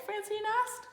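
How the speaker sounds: usual speaking voice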